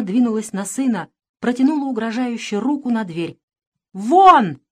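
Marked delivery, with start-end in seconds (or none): 3.9-4.6 very loud, furious one-word order; thundering command. A narrator's reading. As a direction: thunder it, banishing the son. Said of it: "прогремел её голос".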